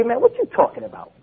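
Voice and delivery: offhand street-style challenge; casual challenge. Shown as a demonstration. Preacher showing how to challenge an overblown talker, casually. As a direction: say it loosely, words run together. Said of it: "Just like that".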